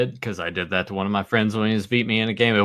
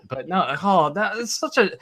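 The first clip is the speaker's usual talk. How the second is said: exasperated rant; 5 semitones higher than usual. Real, not acted. Annoyed by teasing.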